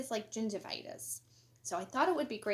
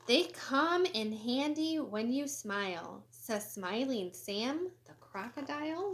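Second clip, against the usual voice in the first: lively, sing-song storybook reading; animated picture-book read-aloud; reading to children; 2 semitones higher, 5 dB louder than usual. Acted. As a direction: read it bright and bouncy for kids. Reads quotes from characters.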